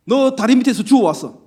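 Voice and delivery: loud, high scolding bark; uncle's scolding. Retold or imitated. Preacher mimics his uncle scolding him, loud scolding. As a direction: scold the boy like a stern uncle. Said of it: "외삼촌이 호통을 칩니다".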